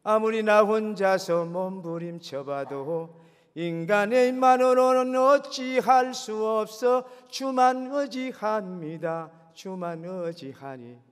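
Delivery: drawn-out pleading prayer over music; impassioned prayer. Acted. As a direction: pour out the prayer at full force. Fervent sung-out prayer over music.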